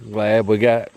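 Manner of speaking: ordinary delivery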